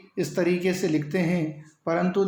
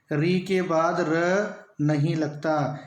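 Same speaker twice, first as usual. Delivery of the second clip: री के बाद र नहीं लगता repeated slowly and deliberately; teacher repeating the rule for emphasis; slower than usual. Shown as a demonstration. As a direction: say the rule again, slow and measured. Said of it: "ध्यान दें".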